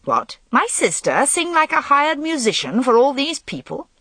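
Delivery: high, indignant outburst; a brother offended for his sister; ill-pleased. A narrator's reading. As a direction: scoff at the very idea. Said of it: "and sounded ill-pleased".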